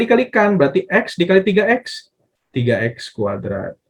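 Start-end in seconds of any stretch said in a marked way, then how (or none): none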